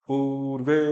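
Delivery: normal talking voice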